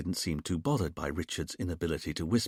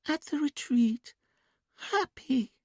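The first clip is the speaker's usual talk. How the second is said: put-on much higher, slow sing-song child voice; childlike sing-song; slower than usual. A narrator's reading. Julia in trance answering the hypnotist, childlike sing-song. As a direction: sing it softly like a little girl. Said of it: "quiet, sing-song voice, almost like a child's".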